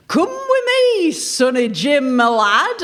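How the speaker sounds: slow, high put-on Yorkshire accent for authority; a mock-stern Yorkshire copper, drawn out slow. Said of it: "in a Yorkshire accent".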